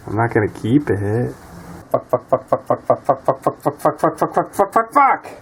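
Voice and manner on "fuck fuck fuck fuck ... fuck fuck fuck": rapid string of curses. Real, not acted. Part breaks while he pulls it.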